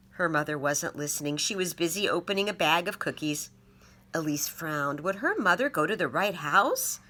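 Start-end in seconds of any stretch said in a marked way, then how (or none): none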